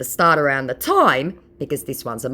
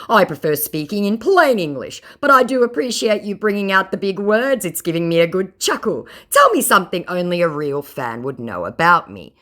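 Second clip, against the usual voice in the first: higher and louder, a smug fake rock star; mocking scammer voice; 7 semitones higher, 5 dB louder than usual. Mocking the fake [name] scammer's messages, higher mock voice. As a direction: read it like a pompous fraud bragging. Reads scammer's chat in put-on voice.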